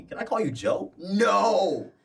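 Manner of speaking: high, firm refusal, slow on don't call me Joe; a playful no to a nickname; mock dialogue. Acted exchange about nickname.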